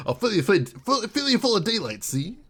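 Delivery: put-on old-timey gangster, see; lightly done 1940s tough guy; noir detective. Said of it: "That's the Noir voice".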